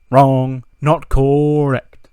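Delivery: loud, very slow taunting "wrong, not correct"; gloating shout. Retold or imitated. Miz taunting the crowd in the ring, slow loud gloat. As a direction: drag it out like a heel gloating to the crowd.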